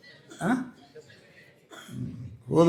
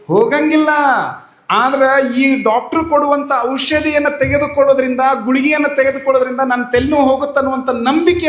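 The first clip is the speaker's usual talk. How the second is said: loud, higher, fervent sermon voice; preaching at full force; 7 semitones higher, 6 dB louder than usual. Acted. Preacher urging devotees to have faith, fervent and loud. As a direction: drive the moral home to the crowd. Preacher drives home the moral.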